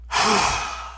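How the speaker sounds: long tired breathy sigh; weary sigh. Acted. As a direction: sigh it out, defeated. Bear exhausted by geese.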